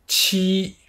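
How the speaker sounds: "Chi" as a TS made with the back of the tongue, held high; Mandarin qi. Shown as a demonstration. Teacher models "Chi" for the class: high level tone. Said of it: "avec le dos de la langue".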